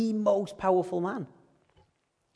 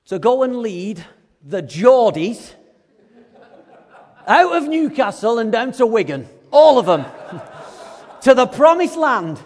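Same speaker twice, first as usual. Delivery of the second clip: louder, higher grand proclamation, laughing; mock God calling; 7 semitones higher, 10 dB louder than usual. God calling the preacher to lead Geordies, loud and laughing. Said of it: "You imagine God calling you".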